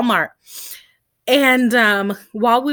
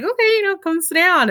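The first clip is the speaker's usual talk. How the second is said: much higher, sing-song okay; playful high reply; 10 semitones higher, faster than usual. Retold or imitated. She answers the joking cousin in a teasing high voice. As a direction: go up high and chirpy to humour him.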